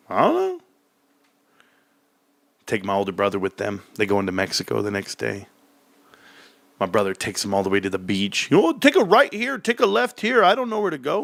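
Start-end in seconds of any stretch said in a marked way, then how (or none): 0.1-0.6 put-on kid voice. Kids answering. 8.5-11.2 clueless brother voice. Retold or imitated.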